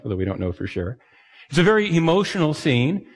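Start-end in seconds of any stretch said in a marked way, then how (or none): none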